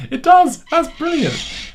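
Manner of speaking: high excited burst of laughter; delighted surprise. Real, not acted. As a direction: squeal with delight. Toy speaks his phrase back.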